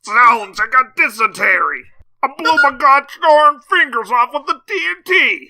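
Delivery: high, loud, wailing prospector; hammy old prospector. Pretend. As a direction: ham up a wounded old miner. Hammed-up prospector blown up.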